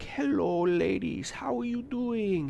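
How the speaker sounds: soft, slow crooning greeting; suave charmer. Retold or imitated. Coworker greeting the translators in his smooth voice. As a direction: croon it like a smooth ladies' man.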